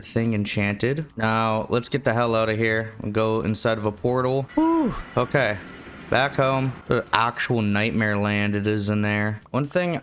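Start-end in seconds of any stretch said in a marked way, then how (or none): 4.5-4.9 high sharp sigh of relief; relieved whew. Real, not acted. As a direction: blow out the tension. Escaped the nether.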